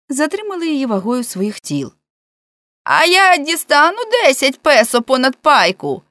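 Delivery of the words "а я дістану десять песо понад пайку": high, loud question; calling from below. A narrator's reading. Man down the well asking for pay, high loud call. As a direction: call it up from the depths. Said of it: "запитав Гієрмо знизу".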